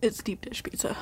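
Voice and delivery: hushed whisper. Real, not acted. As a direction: whisper the comeback. Whispered retort in argument.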